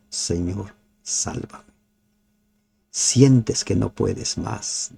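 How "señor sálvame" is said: soft, breathy cry for help; hushed desperate plea. Shown as a demonstration. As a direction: plead for rescue under your breath. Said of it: "Grita como Pedro". (súplica susurrada y desesperada)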